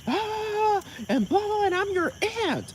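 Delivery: high mocking rant; mock the aunt's squawk up high; angry aunt. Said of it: "be like".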